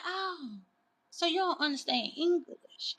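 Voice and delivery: softly, evenly, without raising the voice; calm, quiet. Retold or imitated. To the driver who won't turn around, calm and quiet. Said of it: "I'm talking just like this".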